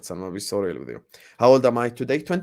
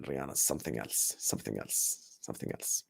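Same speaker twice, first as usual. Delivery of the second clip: awed hush; 11 semitones lower, 13 dB quieter than usual. Real, not acted. Awed muttering about [name].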